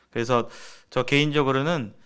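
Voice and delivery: ordinary talk, nothing marked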